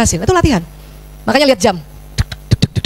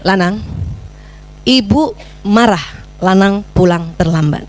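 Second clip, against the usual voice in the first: slow, low, measured telling-off; controlled reprimand; 5 semitones lower, much slower than usual. Shown as a demonstration. Mother reproving her son for coming home late, slow controlled reprimand. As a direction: state it slowly, word by word. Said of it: "tegur perilakunya".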